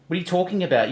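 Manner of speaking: ordinary talk, nothing marked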